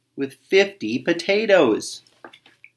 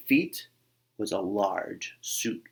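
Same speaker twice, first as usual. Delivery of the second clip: plain reading voice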